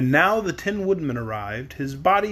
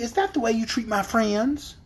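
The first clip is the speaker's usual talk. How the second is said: indignant; 6 semitones higher than usual. Acted. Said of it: "cried Dorothy".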